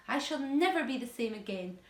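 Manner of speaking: ordinary delivery, nothing marked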